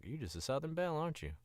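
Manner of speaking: high-pitched, playful teasing; teasing a Southern belle. Acted. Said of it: "meet some people and you're like".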